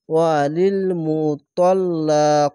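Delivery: ওয়ালিল মুতাল্লাকাতি read slowly, the খা drawn out one alif; slow Quran recitation demo; joined reading, long vowel. Shown as a demonstration. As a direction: stretch the vowel after খা by one beat. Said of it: "এক আলিফ টেনে পড়বেন".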